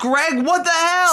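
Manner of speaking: high, loud outraged yell at Greg; angry yell. Acted. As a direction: bellow at him for the scalding tea. Scalded, yelling at clumsy Greg.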